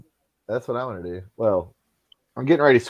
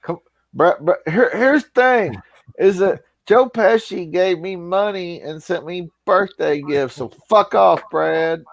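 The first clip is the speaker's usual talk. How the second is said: slow, higher-pitched impression; Jake Hudson; 6 semitones higher, slower than usual. Defending Pesci to Brad in a slow, put-on voice. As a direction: draw it out in a higher Mississippi voice. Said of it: "I can do the Jake Hudson".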